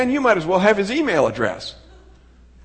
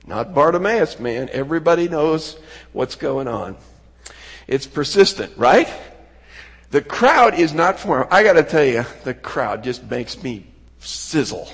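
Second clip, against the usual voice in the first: usual speaking voice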